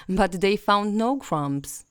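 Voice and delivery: ordinary delivery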